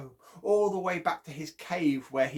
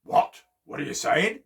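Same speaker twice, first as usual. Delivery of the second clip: raised voice, rising question; loud demand; 7 dB louder than usual. A narrator's reading. Bear asking pinned-down Chipmunk to speak up, loudly demanding.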